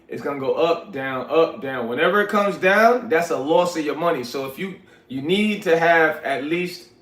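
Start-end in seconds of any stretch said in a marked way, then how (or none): none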